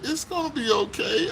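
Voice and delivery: put-on high, gentle voice of a little girl; little girl's comfort. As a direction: reassure like a small girl. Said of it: "put her arms around me and said".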